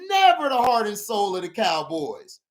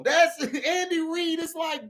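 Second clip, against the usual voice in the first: usual speaking voice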